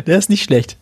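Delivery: speaking through laughter; laughing. Real, not acted. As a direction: chuckle the words out. Laughing while praising joke.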